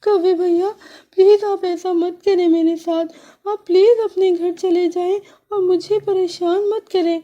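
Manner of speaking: high sparrow voice, pleading through mock tears; high little sparrow with a touch of tears; tearful sparrow. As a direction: beg him, half crying. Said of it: "چڑیا روتے ہوئے بولی".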